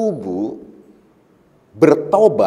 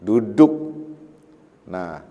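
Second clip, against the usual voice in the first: ordinary talk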